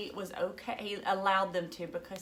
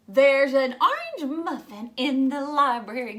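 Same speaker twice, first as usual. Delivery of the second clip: put-on giggly girl; 4 semitones higher, 8 dB louder than usual. A narrator's reading. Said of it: "giggled a girl".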